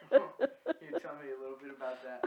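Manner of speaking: asking through laughter; giggling out the question; laughing. Real, not acted. Interviewer laughing while asking.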